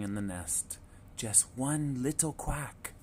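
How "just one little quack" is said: ordinary delivery, nothing marked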